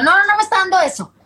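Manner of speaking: high, quick retold protest; insistent denial. Mother retelling her denial of postpartum blues, insistent denial. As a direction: rush out a defensive string of no's.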